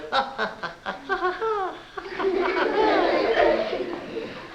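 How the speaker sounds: high cackling laugh of the animals; mocking laugh. Animals jeering at the bald lion, high laugh. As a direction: laugh at him like the whole pack.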